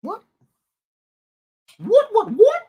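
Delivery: high squeaky what what what; shocked disbelief. Real, not acted. Streamer answering a viewer's odd claim, shocked high squeak. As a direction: jump up into a startled squeak.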